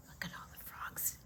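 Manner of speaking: hushed and quick; whisper. Real, not acted. Grandma shows the child the frogs in a fast whisper. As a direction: whisper it as you point.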